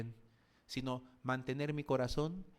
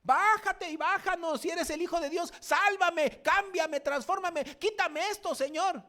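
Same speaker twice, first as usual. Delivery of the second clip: much higher, loud pleading demand in a put-on voice; demanding believer; 7 dB louder than usual. Believer on the cross ordering God to save him, high loud whine. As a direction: whine up at God like a spoiled child. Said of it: "¿cuál ha sido su actitud?".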